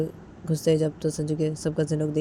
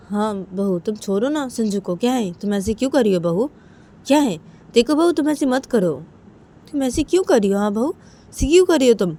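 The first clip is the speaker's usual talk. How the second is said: raised pitch, growing louder; acted scolding; 6 semitones higher, 5 dB louder than usual. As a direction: scold like an exasperated aunt. Aunt scolds daughter-in-law in story.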